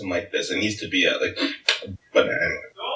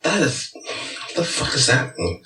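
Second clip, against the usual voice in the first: ordinary talk, nothing marked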